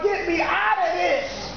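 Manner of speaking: ordinary talk, nothing marked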